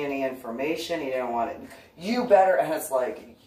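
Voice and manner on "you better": higher, louder sharp you better; loud high warning cut short. Retold or imitated. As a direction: snap a warning at him. Warning the stranger.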